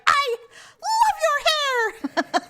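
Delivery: high, very drawn-out forced compliment; imitating mad mom. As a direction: stretch each word in exaggerated mock fury. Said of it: "She was really mad".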